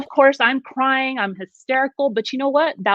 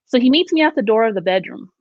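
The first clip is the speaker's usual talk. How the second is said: normal talking voice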